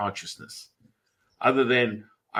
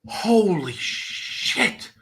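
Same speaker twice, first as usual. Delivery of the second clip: high, drawn-out amazement; re-enacting slow, stunned disbelief; awestruck; 10 semitones higher, much slower than usual. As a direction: stretch each word in stunned wonder. Retelling his astonishment at sudden silence.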